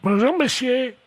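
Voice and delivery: put-on high, sweet old woman; old lady voice. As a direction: greet like a prim granny. Prankster plays the old lady.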